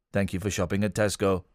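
deep flat robotic drone. Shown as a demonstration. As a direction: speak like a checkout machine. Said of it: "My robot voice".

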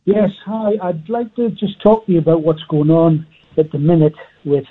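ordinary delivery

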